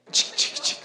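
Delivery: sharp, voiceless "chic" clicks; imitated gun cocking. Preacher warning what you hear nearing the car, imitated gun sound. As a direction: make the sound of a weapon being readied. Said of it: "Vas a sentir un sonido, ¿verdad? De un arma".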